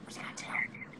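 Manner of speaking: secret whisper into the phone. Real, not acted. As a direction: keep it hushed, others nearby. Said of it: "why you whispering".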